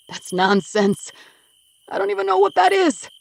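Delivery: frantic denial. Acted. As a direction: wave away the proof, voice cracking upward. Denies the evidence box.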